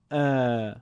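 ا held long and level; long alef. Shown as a demonstration. Teacher contrasting alef maddi with fatha, prolonged. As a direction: stretch ا to double length. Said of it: "دو برابر".

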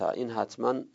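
ordinary talk

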